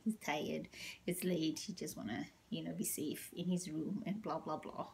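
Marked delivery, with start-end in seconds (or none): none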